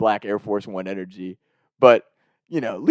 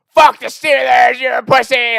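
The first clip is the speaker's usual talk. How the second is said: put-on high, loud, slow drunken yell; drunk fan screaming; 12 semitones higher, 7 dB louder than usual. Drunk Bengals fan yelling at a kid in the bathroom, loud drunken shout. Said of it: "Like, screaming all this shit".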